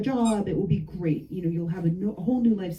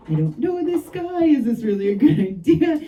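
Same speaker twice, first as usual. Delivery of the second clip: alarmed disbelief; 7 semitones higher, 7 dB louder than usual. Retold or imitated. Fearful thought about stranger's offer.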